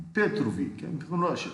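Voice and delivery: put-on Russian accent, rising in pitch. Actor showing his film character, Russian accent. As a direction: play an Eastern European military guy with a Russian accent. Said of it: "I was like this Eastern".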